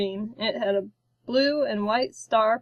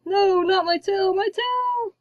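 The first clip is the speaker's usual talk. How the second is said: much higher, strained cry of panic; panicked; 10 semitones higher, 3 dB louder than usual. A narrator's reading. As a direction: yelp as the tail gets bitten. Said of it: "the king panicked".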